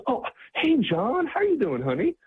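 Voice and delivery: very high, delighted sing-song hello; light up and coo his name; sweet surprised greeting. Retold or imitated. Rhonda suddenly recognizing John, sweet and very high.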